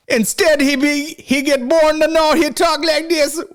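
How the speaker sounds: high-pitched mock Jamaican accent; mock Jamaican patois. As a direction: imitate a squeaky Jamaican patois. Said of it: "Why is he talking like that?".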